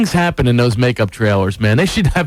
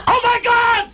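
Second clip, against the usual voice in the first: very high mock wail; retold crying; slower than usual. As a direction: imitate a wife bursting into tears. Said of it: "she really cried for real".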